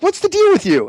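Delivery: can't believe this guy; exasperated. Retold or imitated. Scolding the photographer, very high exasperated cry. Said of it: "I'm like".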